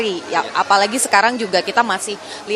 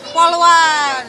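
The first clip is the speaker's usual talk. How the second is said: higher, louder, drawn-out repeat; excited echo; 5 semitones higher, 5 dB louder than usual. Real, not acted. Reporter to a child wanting to be policewoman, excited echo.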